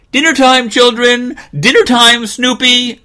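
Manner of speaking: high, loud sustained call; mother calling out. Acted. Mother calling from the kitchen door, high loud holler. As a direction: holler everyone in to dinner. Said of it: "Mother called from the kitchen door".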